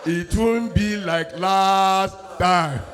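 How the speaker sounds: slow, smooth reassuring drawl; slow seductive. Retold or imitated. Husband sweet-talking the woman he invited, slow and smooth. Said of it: "He say".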